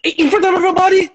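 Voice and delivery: high, fast and indignant; outraged emphasis. Real, not acted. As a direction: pitch it up in disbelief, quickly.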